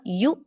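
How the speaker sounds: यू cut short with a jolt; abrupt glottal catch ending यू; jerked hamza. Shown as a demonstration. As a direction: stop abruptly on यू, as a sakin hamza. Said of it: "उसको झटका देके पढ़ें".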